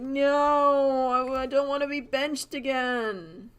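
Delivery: high, drawn-out protest, loud at first; mock wail. Benched player pleading not to be sidelined, mock wailing. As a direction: wail it in mock despair, stretching the words.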